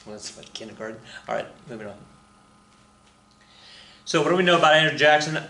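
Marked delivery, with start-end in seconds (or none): none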